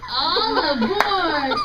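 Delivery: slow drawn-out boarding call; conductor calling out. Acted. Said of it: "The conductor then yelled".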